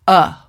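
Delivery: a flat neutral schwa uh; schwa sound. Shown as a demonstration. A therapist demonstrating the neutral vowel uh. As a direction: say a as a short neutral uh.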